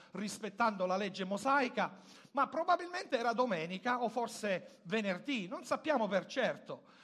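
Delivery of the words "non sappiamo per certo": ordinary talk, nothing marked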